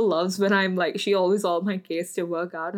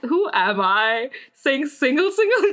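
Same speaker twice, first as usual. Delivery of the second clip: higher, incredulous self-questioning breaking into laughter; baffled at yourself, laughing by the end; mock disbelief; 5 semitones higher, 4 dB louder, slower than usual. Dancer questioning herself mid-chant in class, laughing disbelief.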